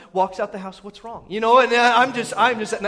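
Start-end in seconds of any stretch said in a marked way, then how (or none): 0.8-1.3 soft, unruffled imitation of a wife; understated calm question. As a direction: ask it quietly, untroubled. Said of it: "the calm one".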